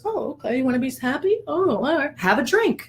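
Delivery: put-on upstairs brain soothing the toddler; indulgent upstairs brain. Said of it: "So the upstairs brain goes".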